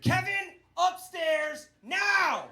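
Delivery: high, sharp parental shout; parent yelling. Retold or imitated. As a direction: summon him upstairs with a yell.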